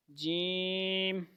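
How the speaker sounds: جیم stretched very long and held; drawn-out jeem. Shown as a demonstration. Quran teacher models جیم for children, long and held. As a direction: hold the sound of جیم out slowly. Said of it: "سخت آواز آئے گی۔".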